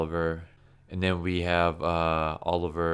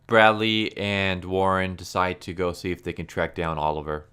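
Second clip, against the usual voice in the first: normal talking voice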